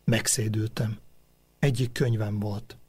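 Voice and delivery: ordinary delivery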